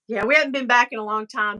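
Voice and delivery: normal talking voice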